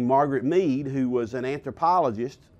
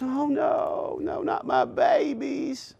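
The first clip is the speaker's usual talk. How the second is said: much higher and slower, hammed-up lament; mock wailing mother; 12 semitones higher, slower than usual. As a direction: wail like a mother losing her babies. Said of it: "And she just goes".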